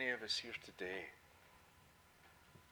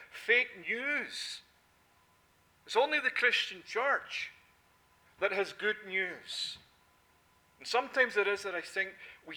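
loud, high, emphatic pulpit preaching; full-force preaching; 5 semitones higher, 10 dB louder than usual. Acted. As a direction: drive every line home to the congregation.

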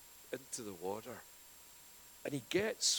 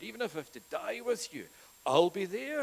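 boastful vow; 9 semitones higher, 9 dB louder than usual. Retold or imitated. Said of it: "even when he had said".

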